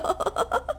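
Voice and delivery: a short, breathless high giggle. Acted. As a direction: giggle escapes her. (ऊँची खिलखिलाहट)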